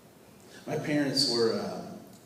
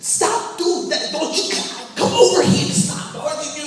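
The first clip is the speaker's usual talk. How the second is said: dad yelling; 10 dB louder than usual. Retold or imitated. Frustrated dad scolding the kids, loud high yelling. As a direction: scold the kids at the top of your voice. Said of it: "Or he'd say".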